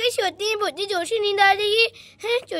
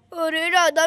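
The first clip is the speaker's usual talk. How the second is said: usual speaking voice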